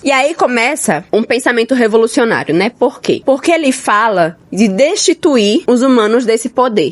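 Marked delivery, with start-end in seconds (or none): none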